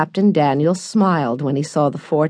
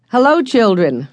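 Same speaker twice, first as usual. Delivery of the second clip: higher, cheerful hello across the dock; called greeting; 6 semitones higher, 3 dB louder than usual. A narrator's reading. Joe greeting the children at the dock, calling out. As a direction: call out to the arriving boat. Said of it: "cried Joe".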